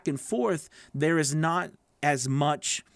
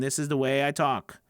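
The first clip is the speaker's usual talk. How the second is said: unvaried, no color in the words; one-note monotone. Shown as a demonstration. Coach shows learners what to avoid, one-note monotone. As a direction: hold every word on the same level pitch. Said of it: "It's not just one way".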